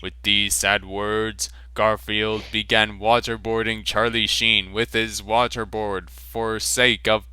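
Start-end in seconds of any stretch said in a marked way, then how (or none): none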